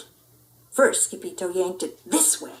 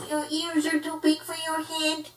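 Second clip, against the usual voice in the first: higher, softer odd alien voice; alien echo; 7 semitones higher, 5 dB quieter than usual. Acted. Martian copying the pup, odd higher voice. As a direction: echo him like a strange alien.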